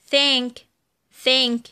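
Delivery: "thank" with breathed th at the start; voiceless TH. Shown as a demonstration. Teacher gives vowel examples, "thank" with voiceless th. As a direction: model "thank" twice with unvoiced th. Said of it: "وتنطق ث".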